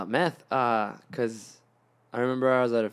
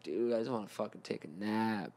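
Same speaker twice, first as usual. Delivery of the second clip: low, much softer, worn-out groan of a line; tired mumble; 4 semitones lower, 11 dB quieter than usual. Retold or imitated. Tired stoner begging his friends for a nap, drained. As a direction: sound too burnt to move.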